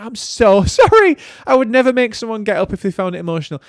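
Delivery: amused mock apology; laughing apology. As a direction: giggle through a sheepish sorry. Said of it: "I was like".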